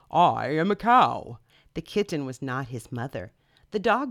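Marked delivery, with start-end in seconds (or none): 0.1-1.4 slow cow voice. Acted. The cow telling the baby bird, loud and slow. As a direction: drag it out like a big slow cow.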